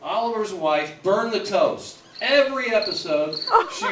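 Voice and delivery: ordinary delivery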